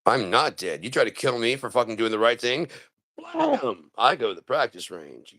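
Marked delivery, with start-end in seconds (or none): 3.2-3.9 high sharp blam; gunshot noise. Acted. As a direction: fire the imaginary gun. Imitated gunshot.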